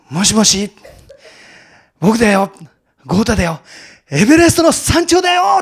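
loud, elated phone call from the summit, building to a high shout; 興奮した大声. Retold or imitated. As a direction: shout the big news down the phone, thrilled. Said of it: "大声で叫んだら". (電話口で興奮して叫ぶ)

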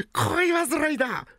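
high, slow, teasing still laughing; mocking laugh. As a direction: tease the lovesick boy. Kuma mocks while laughing.

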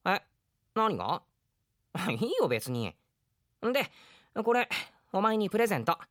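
put-on bright young boy handing over a present; a cheerful kid voice, lightly acted; lively boy character. Drama scene, boy gives gift.

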